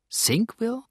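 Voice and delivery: a bewildered, rising echo of Sinkville; astonished echo. A narrator's reading. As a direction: repeat the strange name in amazement. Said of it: "wiederholte Marie erstaunt".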